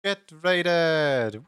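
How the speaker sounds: excited. Real, not acted. Celebrating incoming raid.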